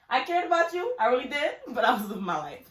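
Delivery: high, loud, laughing admission; laughing confession. Real, not acted. Sister laughing about living her own life.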